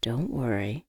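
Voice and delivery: low, soft, calming reply; reassuring. A narrator's reading. Friend reassures the nervous woman, low and soothing. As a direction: settle the caller down gently. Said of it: "he assured".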